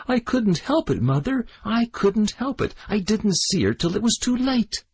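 put-on high, frightened boyish plea; pleading. A narrator's reading. The boy pleads with his furious mother. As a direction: beg her not to strike you.

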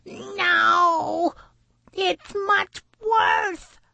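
high-pitched, drawn-out outburst; blurted protest. A narrator's reading. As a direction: blurt it out high and slow, full of objection. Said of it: "Sal blurted out from the shore".

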